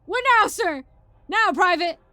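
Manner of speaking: loud, high parade-ground exchange; military shouting. Acted. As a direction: yell it like soldiers.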